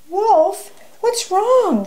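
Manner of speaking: high, startled question; stunned. A narrator's reading. Wolfette reacts to Wolf's outburst, stunned. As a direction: pitch it right up in stunned concern. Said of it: "sighed a stunned Wolfette".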